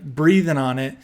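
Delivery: normal talking voice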